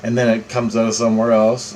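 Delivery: ordinary talk, nothing marked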